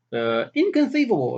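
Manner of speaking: a light nod to the actor's catchphrase; imitating the inconceivable guy. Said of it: "that guy".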